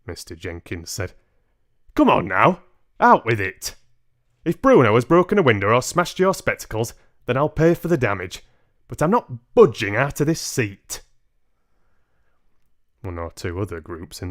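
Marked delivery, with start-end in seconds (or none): 1.9-11.0 louder, higher, pushy big man; a coarse man who won't be moved; blustering father. Acted. Father refusing to leave his seat, loud blustering voice. Father bullies grandmother to speak out.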